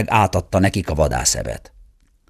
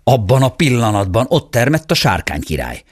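usual speaking voice

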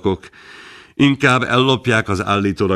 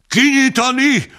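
the same order shouted again, pitched higher still; crowd shout, even higher; 3 dB louder than usual. A narrator's reading. The crowd repeats its demand to open the sack, higher shout. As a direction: push the second shout up further. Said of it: "Hangorkán".

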